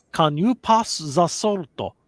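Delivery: slow, "salt" said as "solto", "the" as "za"; exaggerated Japanese-English, vowels added to word ends; broad Japanese katakana-style English; heavy Japanese accent. Shown as a demonstration. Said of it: "I actually speak like that".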